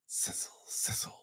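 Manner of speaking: sizzle in a whisper. Acted. Dad reading to a child, whispered sizzle. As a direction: hiss it softly. Final sound list.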